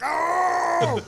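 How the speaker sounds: high, loud mock howl; big comic cry of impact; imitated pained yell. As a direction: howl as if elbowed, hammed up. Imitates elbowed man's cry.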